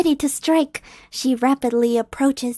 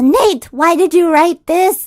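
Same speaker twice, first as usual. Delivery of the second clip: loud, higher mock outrage; exasperated call-out; 5 semitones higher, 8 dB louder than usual. Real, not acted. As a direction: holler at the writer. Calling out the writer.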